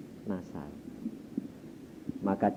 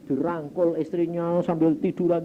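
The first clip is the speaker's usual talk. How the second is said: ordinary delivery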